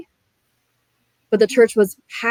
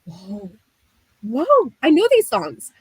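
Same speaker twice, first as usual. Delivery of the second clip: amazed; 3 semitones higher, slower than usual. Retold or imitated.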